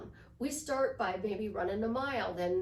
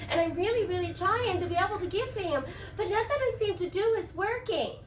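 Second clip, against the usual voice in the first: higher, louder, rushed whining; distressed complaint; 4 semitones higher, 4 dB louder than usual. Acted. Girlfriend complaining that her boyfriend ignores her, distressed and climbing. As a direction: plead in mounting frustration, pitch climbing. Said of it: "You do this instead".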